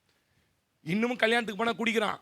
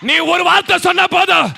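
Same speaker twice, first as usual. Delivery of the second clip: preaching at full force; 10 semitones higher, 16 dB louder than usual. Acted. Repeated prophetic line.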